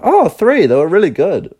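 high airy carefree voice; breezy. Retold or imitated. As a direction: toss it off lightly. Family voiced answering breezily.

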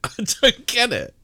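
high, laughing disbelief. Real, not acted. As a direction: giggle through the words.

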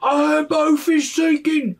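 put-on very high, loud, slow shout in another accent; panicked orc shout. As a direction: scream that the boat is sinking. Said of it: "that's a different accent".

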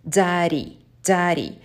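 daddy with a d first and a flapped r in the middle; daddy said dari, English style. Shown as a demonstration. Teacher showing the English daddy sound. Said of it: "the word daddy in English".

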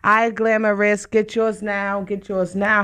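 normal talking voice

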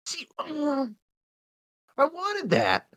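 very high, squeezed-up pitch; mock whine. Fan hearing the comics did it better, mock high whine. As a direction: play it as a little high-voiced complaint.

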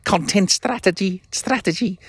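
high-pitched mock Scouse; hammed-up Scouse attempt; bad Scouse accent. Shown as a demonstration. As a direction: push it way up into shaky-attempt Scouse. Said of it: "a Scouse accent".

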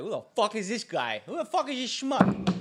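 put-on high, loud Boston accent. Host imitating a celebrity sizing him up, indignant Boston accent. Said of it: "That's a good Boston accent".

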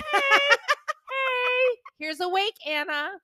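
high chirpy mock hello, laughing; perky greeting. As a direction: chirp it like a bubbly host. Mock filter greeting on FaceTime.

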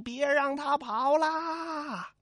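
high, slow, drawn-out yell; a long, stretched mock yell; big shout. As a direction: bellow it across the field. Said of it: "雷横大叫一声".

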